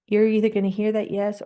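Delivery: ordinary talk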